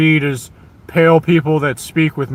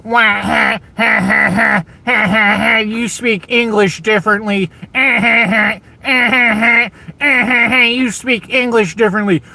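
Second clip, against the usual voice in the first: higher-pitched jeering cackle, taunting; higher mock laugh sneering at an accent; mocking laughter; 7 semitones higher than usual. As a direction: laugh at them like a schoolyard bully, higher up. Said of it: "It would be better if you didn't go".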